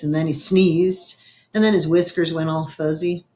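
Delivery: ordinary delivery, nothing marked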